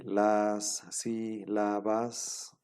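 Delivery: "las sílabas" very slowly, a pause after each syllable; divided into syllables. Shown as a demonstration. Teacher showing students how Spanish divides syllables, slow syllable-by-syllable. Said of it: "así como nosotros dividimos, por ejemplo".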